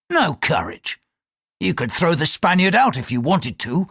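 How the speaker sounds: heated, contemptuous rebuttal; scornful pilot. Acted. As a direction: goad the priest with scorn.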